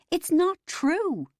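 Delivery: high, loud, slow wail; desperate cry. A narrator's reading. Neil wailing his denial.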